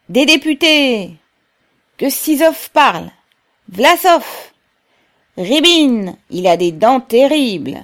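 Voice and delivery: high, loud calling out; crowd cries. A narrator's reading. Workers call for delegates to speak, shouting high and loud. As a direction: shout names from within the crowd. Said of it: "les cris de mécontentement".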